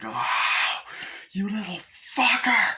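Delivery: groan, then curse the attacker; hammed-up wounded rage; pained fury. Acts out pain and anger.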